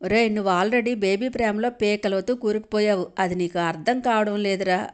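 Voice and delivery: ordinary delivery, nothing marked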